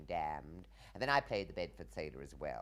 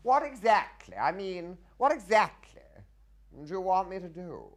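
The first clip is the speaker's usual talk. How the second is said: high, loud stagey indignation; indignant question; 12 semitones higher, 12 dB louder than usual. Retold or imitated. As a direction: demand it like a flustered nobleman. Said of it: "when I had to say to him".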